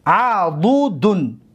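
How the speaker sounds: عضدون stretched slowly, syllable by syllable. Shown as a demonstration. Teacher showing students the word pattern, عضدون slowly syllable by syllable. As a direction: sound out عضدون very slowly for learners.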